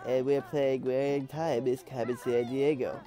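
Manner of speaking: nasally nerd voice with a blocked nose; George Q Gregg. Retold or imitated. Nerd opening his walkthrough for viewers, nasally nerd voice. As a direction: imitate a stuffed-up nerd YouTuber. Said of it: "that nasally voice, that definitive nerd voice".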